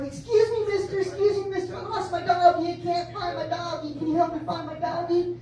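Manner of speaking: put-on very high, breathy-free childish plea; little girl voice. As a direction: play the helpless girl, way up high.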